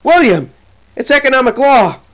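high, loud pleading cry; wife's shriek. A narrator's reading. Wife restrains her angry husband, shrieking protest. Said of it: "She shrieks".

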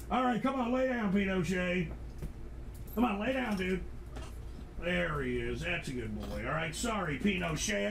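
hushed, gentle coaxing; soothing the dog. Real, not acted. Owner settling his dog Pinochet, hushed coaxing. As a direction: coax the dog to lie down softly.

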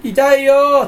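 high, loud, deadpan "ouch"; a monotone wail of pain; flat fake cry of pain. Showing the stock voice that comes out when hurt, a flat wail. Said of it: "ストックしてた声が出ちゃう".

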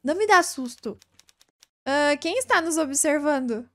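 usual speaking voice

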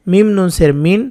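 normal talking voice

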